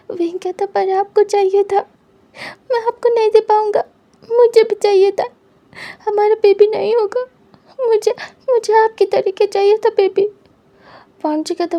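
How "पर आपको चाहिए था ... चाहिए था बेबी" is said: tearful pleading. Acted. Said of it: "wing रोए जा रहा था।".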